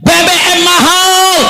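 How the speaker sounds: high, slow mock cry; mock cry of the people. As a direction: plead like the people complaining. Voicing the people crying out.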